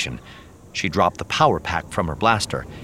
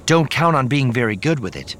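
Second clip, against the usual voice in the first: sharp, higher-pitched caution; warning; 8 semitones higher, 4 dB louder than usual. A narrator's reading. Mara cautioning Luke about his lightsaber, warning tone. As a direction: warn him off overconfidence. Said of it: "Mara warned".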